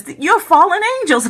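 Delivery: sudden high-pitched gasp of discovery; retold shocked realization. Dreamer realizing the angels are fallen, shocked. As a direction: voice leaps up as it dawns.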